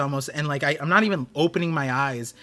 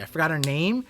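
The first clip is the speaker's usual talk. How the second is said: ordinary talk, nothing marked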